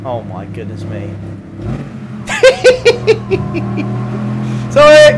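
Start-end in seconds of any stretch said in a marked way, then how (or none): 1.1-4.6 long, high giggling fit; real laughter. Real, not acted. Laughs at his own accent. 4.7-5.2 loud, high burst mid-laugh; laughing apology. Real, not acted. Apologises while cracking up.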